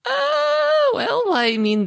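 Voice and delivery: high, stretched-out stalling; playful hedging. Acted. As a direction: dodge the question with a long high "uh". Asked if about to slap.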